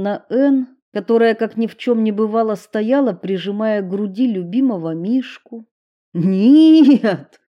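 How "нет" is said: loud desperate yell; shouted refusal. Acted. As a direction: cry out no. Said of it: "крикнул Уилл".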